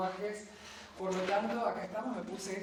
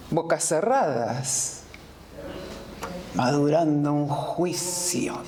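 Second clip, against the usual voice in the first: Clytemnestra addressing her judges; 2 semitones lower, 10 dB louder than usual. Acted. Accused murderess addresses her judges, theatrical and deliberate.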